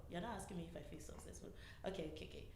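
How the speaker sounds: soft whispered thinking aloud; whispering to self. Real, not acted. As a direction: whisper the question back to yourself. Thinking aloud, repeating question to self.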